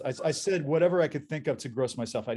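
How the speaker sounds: ordinary delivery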